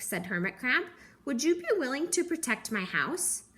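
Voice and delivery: ordinary delivery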